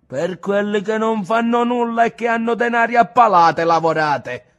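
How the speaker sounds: angry rant. A narrator's reading. Ntoni railing at his grandfather about the rich, loud angry tirade. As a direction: hammer the injustice home.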